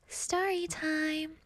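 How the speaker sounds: soft, high, drawn-out singsong; playful story announcement. Pretend. Host announcing a story to viewers, playful singsong. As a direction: stretch it out gently and high.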